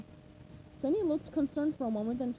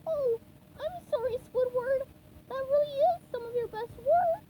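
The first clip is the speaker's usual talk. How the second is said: much higher, wobbling sponge voice, apologetic; tearful apology; 12 semitones higher, 4 dB louder than usual. Acted. SpongeBob apologising to Squidward, near tears. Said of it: "eyes welled up with tears".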